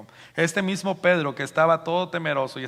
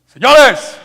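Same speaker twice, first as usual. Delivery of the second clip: very loud, high-pitched call; full-throated shout; 11 semitones higher, 18 dB louder than usual. Shown as a demonstration. Peter addresses thousands at full voice, full-throated shout. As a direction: bellow it to a crowd. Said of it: "imagínense hablando así, ¿no? Con voz en cuello".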